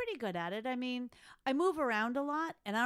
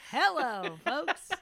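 louder, laughing contestant hello; pageant contestant; 4 semitones higher, 8 dB louder, much slower than usual. Pretend. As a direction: greet the crowd like a pageant contestant. Contestant greets audience.

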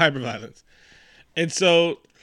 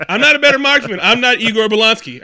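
higher, louder retold outburst; exasperated protest; 5 semitones higher, 5 dB louder than usual. Podcaster voices a gunman refusing to keep shooting, exasperated protest. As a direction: protest loudly, imitating a fed-up gunman.

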